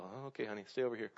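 put-on quietly shepherd a child out of the way; hushed parent. Parent steering kids away.

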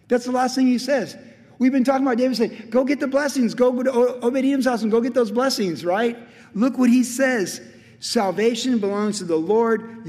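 high, loud, insistent sermon voice; full-force preaching. Acted. Preacher pointing to David's last words, full-force preaching. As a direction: drive it home to the congregation.

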